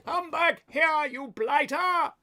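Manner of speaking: high-pitched, drawn-out holler; hammed-up angry yell from a chasing coach; pursuer's shout. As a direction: call out after a runaway at the top of your pitch. Acted cry after fleeing lovers in pursuit.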